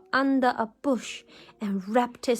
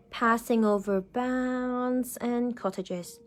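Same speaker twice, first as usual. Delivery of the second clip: plain reading voice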